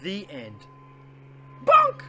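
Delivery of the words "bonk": a sudden shrill, much louder and higher yelp; silly shout. Pretend. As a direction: pop it out like a cartoon sound. Forced silly word at the end.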